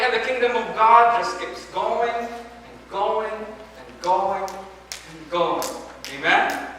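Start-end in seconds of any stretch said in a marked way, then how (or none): 0.0-5.6 slowing fade. Acted. Sermon climax slowing.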